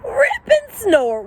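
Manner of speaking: high, slow alarmed squeak on Rip and Snort; a high, slow surprised yelp; startled. A narrator's reading. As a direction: sound caught off guard. Hank startled by coyotes.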